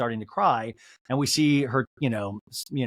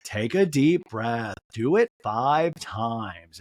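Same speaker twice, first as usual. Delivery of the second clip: slow, lower, drawn-out impression; supportive coaching; 4 semitones lower, much slower than usual. Friend getting her to breathe, slow mock-supportive coaching. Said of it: "very supportive of Lisa".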